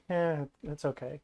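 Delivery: soft, noncommittal dismissal; indifferent shrug. Retold or imitated. Fan rewatching old films, mild shrugging indifference. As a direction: shrug it off quietly.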